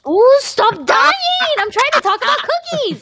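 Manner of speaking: high, drawn-out whiny yell. Real, not acted. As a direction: wail it out in protest. Friend dying mid-conversation.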